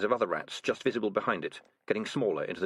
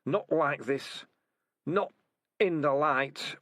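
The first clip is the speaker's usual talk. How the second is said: put-on frail old rat; 6 semitones higher, much slower than usual. Dying old rat voiced.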